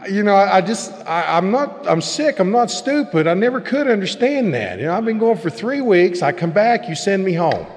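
heated complaint, half reliving it; exasperated outburst. Retold or imitated. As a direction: pour out the old anger at the school. Retold frustration at the school.

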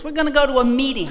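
loud, high, fast order; brisk announcement; bossy Martha. Retold or imitated. Martha telling her they're going to a meeting, brisk and loud.

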